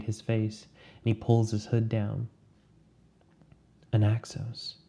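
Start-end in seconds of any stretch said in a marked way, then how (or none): 3.9-4.7 a lightly voiced heavy English accent, breathed; breathy English accent. A narrator's reading. The stranger reveals himself to Anaxos, breathy English accent. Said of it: "breathes in a heavy English accent".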